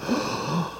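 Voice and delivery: short sharp intake of breath; retold gasp. Border officer sees the friar's robe, startled gasp. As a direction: gasp in shock at the passport photo. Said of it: "and went".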